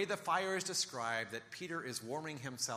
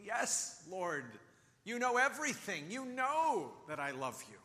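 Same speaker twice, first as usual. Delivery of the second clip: higher, pleading exasperation; exasperated; 6 semitones higher, slower than usual. Acted. Peter answering Jesus a third time, exasperated. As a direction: burst out, fed up with being asked. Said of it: "Peter is exasperated".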